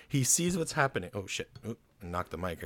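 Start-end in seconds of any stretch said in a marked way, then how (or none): 0.1-1.1 high-pitched excited storytelling; carried away by the story; real excitement, pitched up; got excited. Real, not acted. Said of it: "I got excited".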